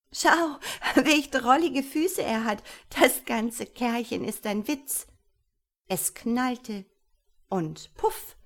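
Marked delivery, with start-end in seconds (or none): 0.1-5.1 high, loud, giggly ridicule; laughing mockery. Sister mocks the tiny wizard's feet, loud and amused. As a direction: poke fun, bursting with amusement. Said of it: "doch sie lachte und lachte".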